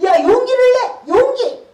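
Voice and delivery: high, loud rallying shout with a laugh; rallying cry. Retold or imitated. Speaker urging herself to be brave, loud and high. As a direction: cheer yourself on out loud. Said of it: "용기를 내는 거야".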